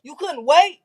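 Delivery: high, angry yelling; angry shout. Retold or imitated. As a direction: yell at her, outraged. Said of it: "He was like".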